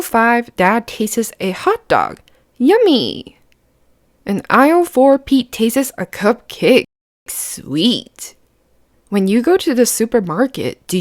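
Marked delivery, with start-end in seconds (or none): 2.6-3.4 delighted. Acted. Dad tastes a hot dog. 7.3-8.4 breathy whispered delight. Acted. Pete tastes a cupcake.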